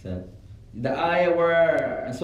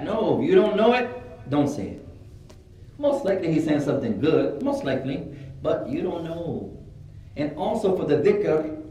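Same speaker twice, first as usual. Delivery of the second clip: ordinary delivery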